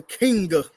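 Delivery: "Kinga" said as King plus "uh"; mocking mispronunciation. Critic querying a singer's "Kinga" for King, mispronounced. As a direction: repeat the botched "Kinga" with its extra A. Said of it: "mispronounce King with an extra A on it".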